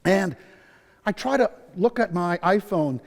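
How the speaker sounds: ordinary delivery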